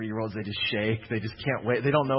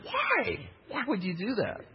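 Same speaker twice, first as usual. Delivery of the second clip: put-on much higher, wounded child's whine; whining little kid; 10 semitones higher, slower than usual. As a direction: plead like a betrayed toddler. Child's look voiced.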